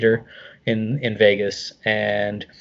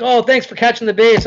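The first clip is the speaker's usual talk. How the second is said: casual Mark; 8 semitones higher than usual. Retold or imitated. Mark casually thanking him.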